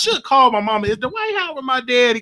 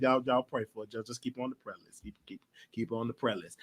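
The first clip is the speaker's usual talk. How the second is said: much softer, lower mumble; mumbled aside; 11 semitones lower, 15 dB quieter than usual. Real, not acted. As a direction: toss off a quiet prayer request under your breath.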